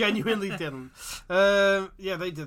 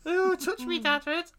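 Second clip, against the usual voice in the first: mock flirty; 14 semitones higher, 6 dB louder, slower than usual. Mock flirting with Hagrid joke.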